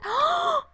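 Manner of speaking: loud, high-pitched gasp; sharp high gasp. Real, not acted. Recalling a beloved cat, high excited gasp. Overcome remembering her old cat.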